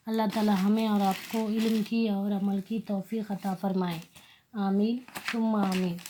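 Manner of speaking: soft, devout Arabic recitation; quiet prayer recitation. Real, not acted. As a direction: recite the dua softly before class. (धीमी आवाज़ में दुआ)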